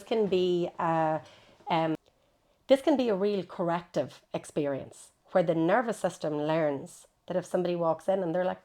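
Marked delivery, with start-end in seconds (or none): none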